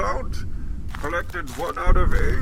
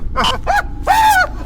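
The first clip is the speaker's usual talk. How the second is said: shouted laugh; 5 dB louder than usual. Acted. Mock dog bravado.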